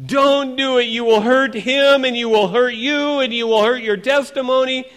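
high, loud, insistent pleading; urgent warning. Acted. Inner voice warning an angry believer, urgent and loud. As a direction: press each warning home, high and loud.

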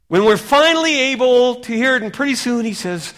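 loud, high fervent preaching; full-force revival preaching. Acted. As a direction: preach it out to the hall.

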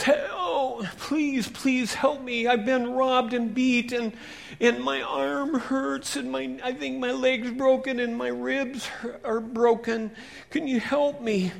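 high, pleading cry for help. Acted. Beaten man begging the passing priest for help, high pleading. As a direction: beg him to stop.

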